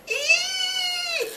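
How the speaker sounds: shrill, very high scream. Acted. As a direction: shriek in fright. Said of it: "steps back screaming".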